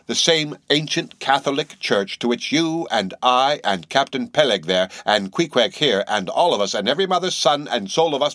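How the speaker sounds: lightly intoned sermon; mock sermon.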